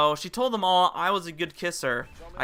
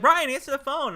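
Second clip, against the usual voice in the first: higher, louder mock summons; imitated call; 8 semitones higher, 6 dB louder than usual. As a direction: call out to summon a friend, playfully. Imitates classmate calling to him.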